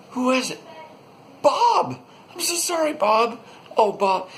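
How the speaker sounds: squeaky high, loud mock-grief wail; cartoonish high-pitched apology shouted at a fallen buddy; panicked soldier. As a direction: overplay the horror of stabbing your friend. Soldiers mourning the comrade they killed.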